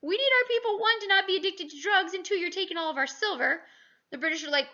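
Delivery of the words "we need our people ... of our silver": much higher pleading voice; teacher voicing the Chinese, mock exasperated; imitating the Chinese. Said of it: "the Chinese going".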